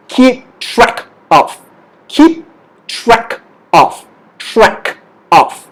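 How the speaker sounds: slow, spaced words for repeating; one word at a time, pauses between; slow model phrase. Shown as a demonstration. Teacher leads learners at home, slow and spaced out. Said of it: "Say it with me".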